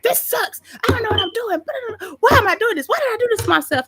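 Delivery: high-pitched, frantic mock wail; hammed-up overwhelmed squeal; retold screaming meltdown. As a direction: re-enact a frustrated outburst, speeding up toward the end. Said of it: "like, screaming".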